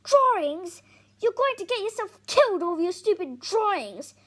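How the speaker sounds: high, loud incredulous shouting; near shout. Acted. Dad almost shouts at Tom over his drawings. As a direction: nearly shout it in disbelief. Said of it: "Dad almost shouts".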